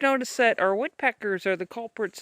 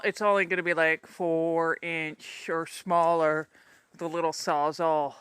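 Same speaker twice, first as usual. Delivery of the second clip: normal talking voice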